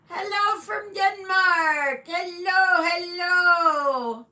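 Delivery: high, loud, drawn-out sing-song hello; hello stretched long and sung out; cheery greeting. Real, not acted. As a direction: call out a big welcome across the room. Greeting a viewer from Denmark.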